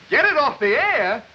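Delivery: high, slow, stunned repeat; can't believe the show is cancelled; shocked echo of the order; aghast disbelief. Acted.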